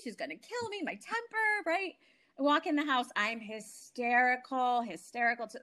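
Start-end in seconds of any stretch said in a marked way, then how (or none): 0.0-1.7 high, softened worried squeal; retold panic. Daughter dreading her mother's reaction after storming out, retold panic. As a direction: replay the dread in a much higher voice.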